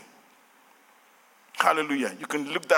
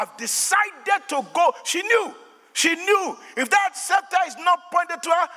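high, urgent sermon climax; impassioned preaching; 9 semitones higher than usual. Real, not acted. Preacher telling Esther's story with nation at stake, high fervent preaching. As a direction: build to a fervent, pressing appeal.

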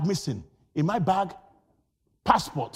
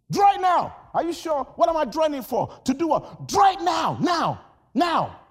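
commands and doubts; 6 semitones higher, 3 dB louder than usual. Acted. Command versus doubting servants.

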